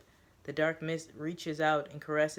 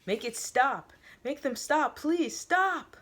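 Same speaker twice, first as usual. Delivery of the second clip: higher, louder, climbing desperate cries; screaming plea; 10 semitones higher, 6 dB louder, slower than usual. A narrator's reading. Voices in his head, screaming desperate pleas. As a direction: scream for it to end.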